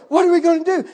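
high, loud frantic question; frantic panic. Retold or imitated. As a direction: cry it out in a panic. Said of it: "I'm just falling apart".

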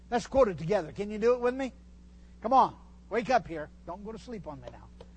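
ordinary talk

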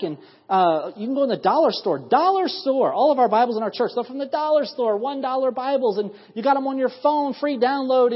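high, rising preacher's build, racing in places; fervent preaching. Acted.

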